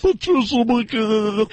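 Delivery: high, very slow name drop; Patricia McCann. Retold or imitated. Host mocking her voice at her photo, high drawn-out parody. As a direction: drag the name out in mock announcer voice. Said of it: "her face matches her voice".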